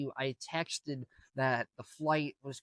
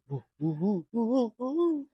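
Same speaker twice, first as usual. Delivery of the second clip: rising high, loud "woo-woo" whine; mock whining; 8 semitones higher, 5 dB louder than usual. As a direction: mimic a complainer with climbing hoots. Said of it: "is still complaining and stuff".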